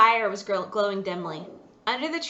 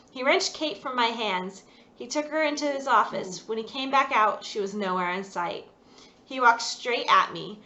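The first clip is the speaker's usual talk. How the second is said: ordinary delivery, nothing marked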